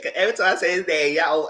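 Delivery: ordinary talk, nothing marked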